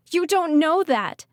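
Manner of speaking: high, loud, slow distraught shout. Acted. Ridley shouting at Archer, frantic about her friends. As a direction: shout it back in protest. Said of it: "she shouted".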